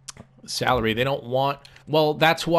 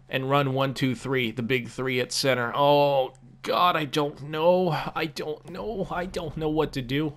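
normal talking voice

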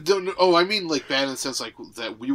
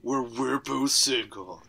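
slow, whispery movie trailer narrator; drawn-out, hammed-up trailer pitch; mock trailer voice; slower than usual. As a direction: play the trailer announcer as a seducer. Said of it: "my trailer voice".

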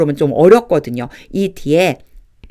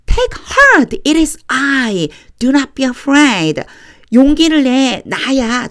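reassuring Jesus; 7 semitones higher, much slower than usual. Acted.

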